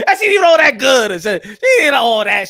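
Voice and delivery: loud, hammed-up put-down; yelled comic dismissal; scornful outburst. Comic deciding she wasn't all that, loud scorn.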